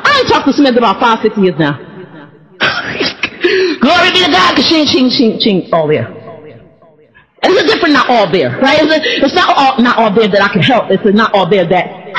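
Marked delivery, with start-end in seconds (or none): none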